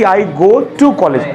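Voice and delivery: slow, careful example sentence; slow model sentence. Shown as a demonstration. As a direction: spell it out slowly for students. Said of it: "तो हम लोग बोलते हैं कि". (धीमी, साफ़ उदाहरण वाली आवाज़)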